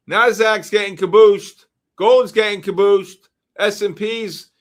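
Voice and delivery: loud, excited market alert; urgent call-out. Real, not acted. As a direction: call out the sudden drop. Calling out a sudden market drop.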